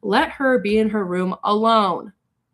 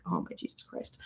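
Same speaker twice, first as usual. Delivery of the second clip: a low, soft whispered "Oh my Jesus Christ"; whispered dismay; 15 dB quieter than usual. Retold or imitated. Reacting to thousands of violent comments, a low whisper. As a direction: breathe it out under your breath in disbelief. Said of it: "I'm like".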